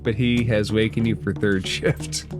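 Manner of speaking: normal talking voice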